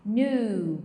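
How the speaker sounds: Knew said as nu with a long u, no k sound; pronouncing knew. Shown as a demonstration. Teacher modeling the word for students, clear pronunciation. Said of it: "É como se fosse um U longo".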